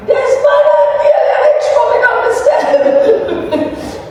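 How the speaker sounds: put-on high, loud wail of a tattling child, laughing through it; childish crying yell. Small boy complaining to granny about cousins, high laughing wail. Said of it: "used to run up my granny and cry".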